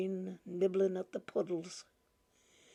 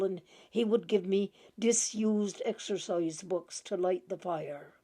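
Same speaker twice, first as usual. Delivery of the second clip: ordinary delivery, nothing marked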